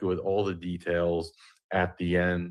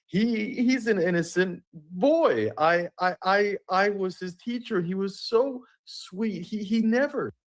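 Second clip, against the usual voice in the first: mock drama teacher; 13 semitones higher than usual. YouTuber mocks the drama teacher defending the inmate, high and stammering. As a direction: play the doting teacher, high and halting. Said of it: "Is gonna say".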